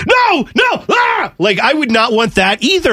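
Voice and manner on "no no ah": very high mock shriek; disgusted toilet scream. Co-host voicing a horrified toilet, very high mock shriek. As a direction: shriek in horror. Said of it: "it's just like".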